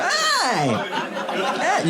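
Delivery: high, bright shouted greeting. Retold or imitated. Comic mimicking a greeting heard during his set, high call. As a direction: call out hi across the room. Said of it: "you can hear".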